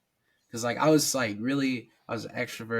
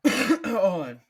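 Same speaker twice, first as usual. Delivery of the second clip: cough to clear throat; 11 semitones higher, 10 dB louder than usual. Real, not acted. Clearing a raspy voice.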